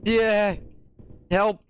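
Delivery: high urgent plea; calling for help. Real, not acted. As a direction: plead for rescue. Calls for help under fire.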